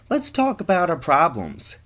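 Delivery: high, loud hipster chirp; mock hip-culture voice. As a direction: pitch it way up as a parody of hip talk. Hipster mocking new hip culture.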